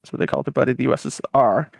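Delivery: normal talking voice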